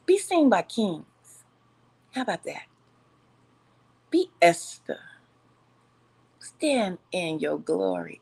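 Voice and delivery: measured preacher delivery to the viewers; preaching. Acted. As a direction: exhort the sisters like a pulpit talk.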